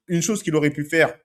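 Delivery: ordinary delivery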